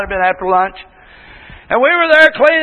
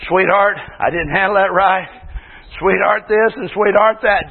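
lower, subdued husband's apology; humble apology; 6 semitones lower than usual. Acted. Husband apologizing to his wife, humble and subdued. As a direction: own up gently to a sweetheart. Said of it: "be willing to get out and say".

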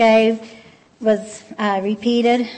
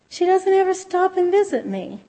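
higher, lightly voiced neighbor, a touch plaintive; a hint of a wounded neighbor's complaint; neglected neighbor; 7 semitones higher than usual. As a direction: voice the lonely neighbor gently, pitched up.

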